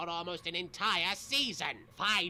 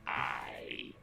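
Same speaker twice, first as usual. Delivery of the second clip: breathy, hushed villain; sinister whisper; 8 semitones lower, 4 dB louder, slower than usual. Acted. Dark Marek revels in his rebirth, whispered menace. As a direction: breathe the lines out like an evil spirit.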